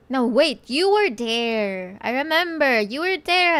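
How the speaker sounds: loud, high sudden recall; suddenly remembering, loud; excited realization. Real, not acted.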